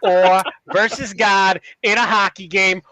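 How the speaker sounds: put-on slow, emphatic Boston accent; hammed-up Boston accent. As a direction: pose the riddle like a Boston sports fan. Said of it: "I'm reading this in a Boston ac".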